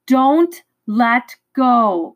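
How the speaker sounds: slow, far-off, as if through mist; slow and distant, fading into the fog; voice already remote. A narrator's reading. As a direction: call back from a distance, drawn out. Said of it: "his voice already remote".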